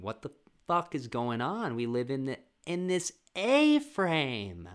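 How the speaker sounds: put-on high, incredulous kids' voice, a whisper in the middle; bewildered children grilling their father; kids questioning dad. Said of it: "with the kids wondering".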